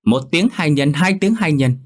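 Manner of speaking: scolding the maid for saying his name; rising scold. Acted. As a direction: hammer "tiếng" harder the second time.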